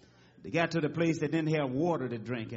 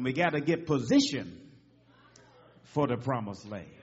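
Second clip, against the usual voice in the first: ordinary delivery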